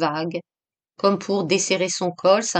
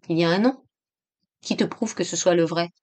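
toneless doubt; faster than usual. A narrator's reading. Stricken friend doubts the printed name. (doute morne, voix éteinte)

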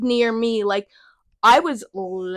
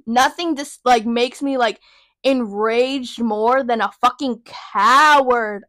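normal talking voice

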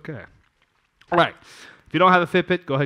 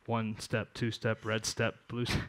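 Dr. Seuss rhyme; 5 semitones lower, 10 dB quieter than usual. Pretend. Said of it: "You ever read Doctor Seuss?".

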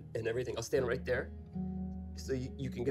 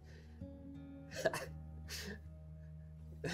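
bitter laugh; 9 semitones higher, 8 dB quieter than usual. Acted.

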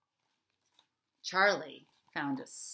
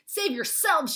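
loud, high heroic yell; a kid hero shouting a warning; shouted; 9 semitones higher, 8 dB louder, slower than usual. A narrator's reading. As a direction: call out bravely to the family. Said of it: "shouted Charlie".

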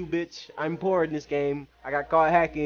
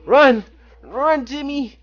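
high, loud urging to run; urgent cheering; 10 semitones higher, 5 dB louder, slower than usual. Real, not acted. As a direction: cheer him on to flee.

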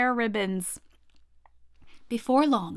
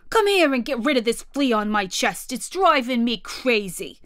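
louder, fed-up raging gorilla; 2 semitones higher, 4 dB louder than usual. Acted. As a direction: rant about the fleas, fuming. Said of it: "he raged".

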